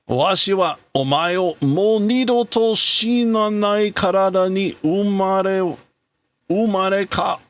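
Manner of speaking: a grieving scientist swearing to rebuild his son; grieving father. Acted.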